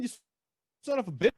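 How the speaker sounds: imitated cartoon duck outburst; quick mock cartoon curse; Daffy Duck. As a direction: put on an indignant cartoon duck voice. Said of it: "you got Daffy Duck, and he, he's like".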